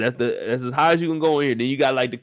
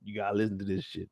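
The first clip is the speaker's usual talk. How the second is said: normal talking voice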